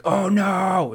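loud, high dramatic cry; mock alarm. Podcaster acting an elf who learns the forging is nearly done, mock alarm. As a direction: cry out in hammed-up dismay. Acts Galadriel's alarm before running.

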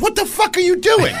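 high-pitched indignant outburst; mock-indignant screech at a rude customer; outraged. Retold outraged restaurant girl.

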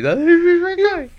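fast, high whining; whiny high voice. Acted. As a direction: whine it like a kid. Mock whiny complaint.

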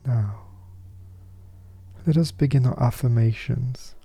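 ordinary talk

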